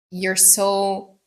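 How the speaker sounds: ordinary delivery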